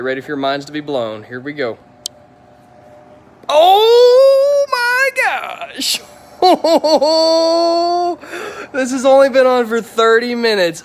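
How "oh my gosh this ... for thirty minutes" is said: very high, slow, squealing "oh my gosh" that breaks into laughter; real overwhelmed delight, nearly crying with joy; overjoyed squeal. Real, not acted. Detailer seeing the finished red car, squealing with delight.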